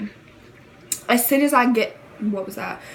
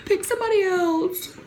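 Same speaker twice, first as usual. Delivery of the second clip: high, laughing half-sobbed protest; mock crying plea; 9 semitones higher than usual. Retelling the prank to viewers, high mock-crying whine. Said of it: "like, crying and stuff".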